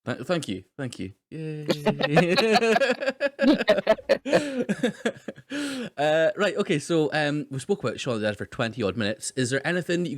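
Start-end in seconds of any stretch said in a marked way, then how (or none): 1.7-5.9 long high zombie wail; zombie moan. Real, not acted. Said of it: "That might be the best".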